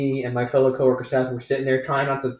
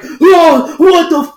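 much higher, much louder shocked shriek; shocked reaction; 13 dB louder, slower than usual. Retold or imitated. Imitating TikTok viewers reacting to a nude show, shrieking. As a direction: squeal like you just saw it. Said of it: "They were like".

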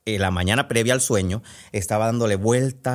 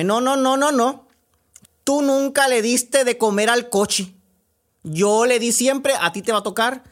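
much higher, whiny refusal; stubborn brother; 10 semitones higher than usual. Acted. Brother refusing to help his sister grind, whiny. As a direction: refuse like a kid insisting it's not his turn.

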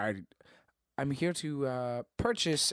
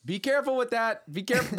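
higher-pitched fretful caution; anxious warning; 7 semitones higher than usual. Acted. Owner warning handlers about family heirlooms, fretful. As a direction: fuss over precious cargo.

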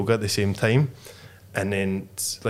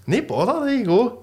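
squeaky high mock shop-worker voice; mimicking the server; 13 semitones higher, 3 dB louder than usual. Server handing food to the lass, high mock chirpy voice. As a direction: pitch it way up, chirpy server handing over the order. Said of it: "and he goes".

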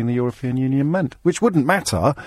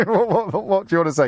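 ordinary talk, nothing marked

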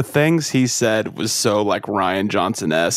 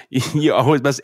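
ordinary talk, nothing marked

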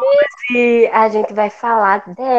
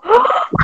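gasp; 13 semitones higher than usual. Retold or imitated. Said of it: "eu fiquei tipo".

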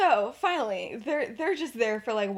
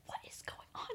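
hushed, voiceless whisper; 21 dB quieter, faster than usual. Retold or imitated. Lily asks James under her breath, whispering. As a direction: whisper so the centaurs won't hear.

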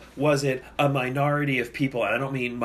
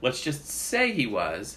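slow emphatic; slower than usual. Real, not acted. Repeated slowly for emphasis.

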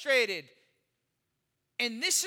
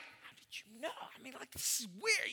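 put-on hushed, guilty whisper of a kid; caught-out whisper; 9 dB quieter than usual. Teen caught by his praying mom, whispering in disbelief. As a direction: whisper it like you've been caught. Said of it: "I'm like".

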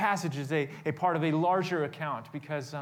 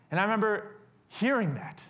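ordinary talk, nothing marked

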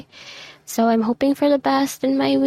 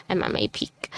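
drained whisper; 4 semitones lower, 6 dB quieter than usual. Real, not acted. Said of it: "Like me".

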